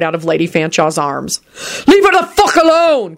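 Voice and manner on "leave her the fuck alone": high, furious yell; bellowed. A narrator's reading. Quentin to man snatching Natalie, bellowing. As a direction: bellow in protective rage. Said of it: "Quentin bellowed".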